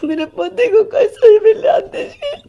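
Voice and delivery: high, crying, desperate begging; sobbing plea. Real, not acted. Wife begs to get her husband back, sobbing.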